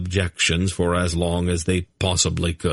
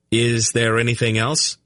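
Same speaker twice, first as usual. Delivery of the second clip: slightly dangerous; 5 semitones higher than usual. A narrator's reading. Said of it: "slightly dangerous tone".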